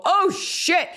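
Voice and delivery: high-pitched panicked outburst, re-enacted; sudden high yelp of alarm; retold alarmed cry. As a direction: cry out as if watching the accident. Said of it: "you hear me go".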